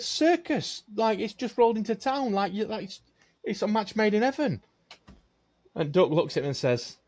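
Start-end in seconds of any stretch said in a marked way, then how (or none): 0.0-4.6 high-pitched, insistent enthusiasm; excited barman. Acted. Barman sells the circus job to a duck, excited. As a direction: push the idea in a raised, eager pitch.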